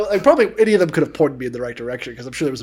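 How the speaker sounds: usual speaking voice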